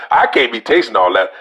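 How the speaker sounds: normal talking voice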